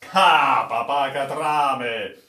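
loud, amused captain's slow exclamation; exclaiming, amused. Acted. The captain laughs at the sailor's siren story, exclaiming. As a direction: cry out in amused disbelief. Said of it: "esclamò il capitano".

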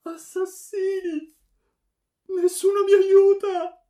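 very high, drawn-out shrieks; agonized screaming. A narrator's reading. Stabbed daughter crying that nobody helps, screaming. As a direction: scream slowly as you fall wounded. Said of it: "Cadde urlando".

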